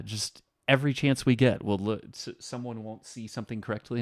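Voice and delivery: ordinary talk, nothing marked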